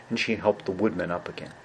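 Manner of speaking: usual speaking voice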